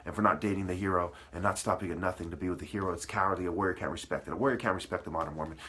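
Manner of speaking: ordinary talk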